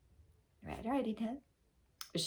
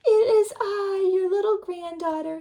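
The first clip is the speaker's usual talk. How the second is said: put-on little girl; 12 semitones higher, 8 dB louder than usual. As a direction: sound small and sweet.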